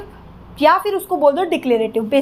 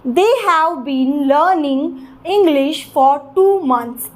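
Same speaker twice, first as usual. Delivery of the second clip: slow, stressing "learning", "English", "two", "months"; sentence stress demo; much slower than usual. Shown as a demonstration. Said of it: "मैंने learning पे stress दिया". ("learning", "English", "two", "months" पर ज़ोर, धीरे-धीरे)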